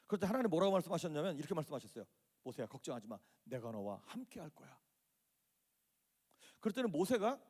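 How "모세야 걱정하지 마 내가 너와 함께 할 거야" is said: soft, low, unhurried voice of God; quiet, fatherly comfort; tender reassurance. Acted. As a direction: reassure gently, dropping low and slowing toward the end. God reassures a reluctant Moses.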